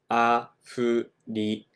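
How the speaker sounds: Afrika drawn out syllable by syllable; ゆっくりアフリカ; slow Japanese Afrika. Shown as a demonstration. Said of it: "If you want to say".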